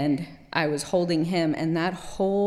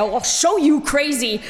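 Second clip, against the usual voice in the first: loud, higher sassy comeback; defiant retort; 6 semitones higher, 4 dB louder than usual. Retold or imitated. Daughter taunting the soldier who called her crazy, defiant. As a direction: throw it back at him with attitude. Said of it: "I said".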